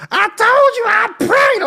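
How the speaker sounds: put-on high, loud bratty teen whine; whiny Dolores. The girl snaps at the officers in a high bratty whine. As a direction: whine it like a sulking kid. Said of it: "Dolores voiced whiny".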